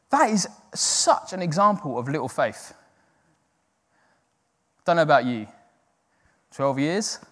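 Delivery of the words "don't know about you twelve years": ordinary delivery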